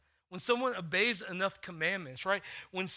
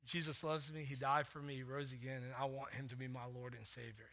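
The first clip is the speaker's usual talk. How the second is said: put-on soft, hushed, childlike confession; simple sincere faith; 5 semitones lower, 9 dB quieter than usual. Pastor echoing his daughter's faith, soft and tender. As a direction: say it gently, the way a child would believe it.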